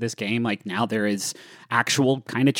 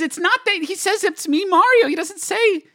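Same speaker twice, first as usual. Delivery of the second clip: much higher and louder, exasperated disbelief; incredulous protest; 5 dB louder than usual. Real, not acted. Host protesting loudly at co-hosts about Mario. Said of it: "No, guys".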